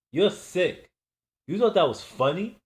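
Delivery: asking the laughing boss if the prank was funny; angry. Acted. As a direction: confront him, offended. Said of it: "I asked angrily".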